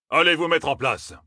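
high-pitched order; commanding. A narrator's reading. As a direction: order them into position. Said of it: "ordonna Ammar".

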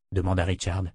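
usual speaking voice

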